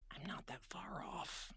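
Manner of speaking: whispered aside. Real, not acted. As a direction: whisper it under your breath. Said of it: "aside whispered to audience".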